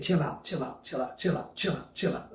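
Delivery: soft, soothing repeat; soft hushing. Pretend. God calming his people, soft and soothing. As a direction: hush them like a calm voice.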